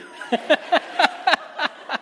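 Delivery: high, breathy wheeze of a laugh. Real, not acted. The storyteller wheezing with laughter at his own fiasco. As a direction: let a silent laugh wheeze out.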